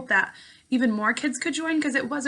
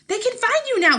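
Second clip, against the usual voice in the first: a narrated scream, much higher and a bit louder; a frightened mother crying out, higher pitched in a read-aloud way; mock scream; 13 semitones higher, 7 dB louder than usual. Mother panicking at her small son near the computer, high mock scream. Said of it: "she screamed".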